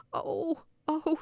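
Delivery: high, loud storybook wail; shouted cry. A narrator's reading. Old man with sausages stuck to his nose, shouting. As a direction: cry out in sudden alarm. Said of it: "shouted the little old man".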